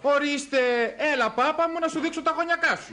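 high-pitched sarcastic retort; exasperated mockery. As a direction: throw the accusation back mockingly. Exasperated at being accused. (ψηλόφωνη, ειρωνική αγανάκτηση)

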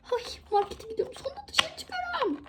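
put-on high, squealing child voice; little girl thrilled to go to the market; excited squeal. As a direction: squeal with joy about going out.

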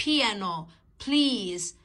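"بيانو، بليز" with popped aspirated p; heavy p. Shown as a demonstration. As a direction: press the lips, then burst into "بيانو" and "بليز". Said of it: "شفايفي لازم تلمس بعض". ("بيانو، بليز" بنطق p تقيلة)

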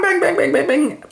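high, rapid-fire "bang" noises; imitated swordfight clashes. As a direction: voice the clashing rapiers. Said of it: "And they go".

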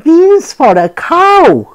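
high, loud outraged cry; cried out. Acted. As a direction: cry out in disbelief. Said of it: "cried Mickey".

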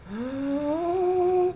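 sharp high delighted gasp; a quick excited intake of breath. Real, not acted. Reacting to a viewer name.

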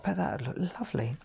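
quick whispered idea; whispered thought. Retold or imitated. Crafter imagining her printed card, whispered quickly. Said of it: "I thought".

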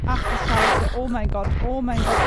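loud panicked yelling; panicking gamer. Real, not acted. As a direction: shout in terror while running. Monster chasing player in game.

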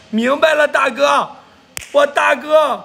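high, loud hail to a patron; pumped-up livestream hype; excited call-out. Real, not acted. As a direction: call out loudly to big brother. Hailing a big-spending viewer.

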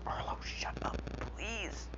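soft unvoiced whisper; whispered thought. Narrating his story, Izuku silently tells Arlo to shut up in a whisper. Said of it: "in his head, he's like".

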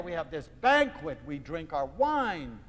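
plain reading voice

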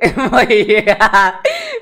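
big loud laugh, cracking up; loud real laugh that breaks out; loud burst of laughter. Real, not acted. Laughs at her own edits.

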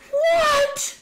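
a loud, high, startled "What?". Retold or imitated. Justine yelling at the council meeting in loud disbelief. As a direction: blurt it out high and loud in shock. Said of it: "in a really loud voice".

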